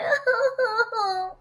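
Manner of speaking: a burst of cartoon sobbing; short sob. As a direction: break down into a sob. Wife breaks into crying.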